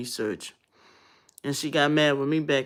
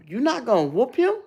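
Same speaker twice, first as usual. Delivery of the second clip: much higher and louder, outraged; high, indignant mock demand; girlfriend's indignant voice; 9 semitones higher, 4 dB louder than usual. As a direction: mimic the girlfriend, shocked he won't discipline. Girlfriend demands he punish son.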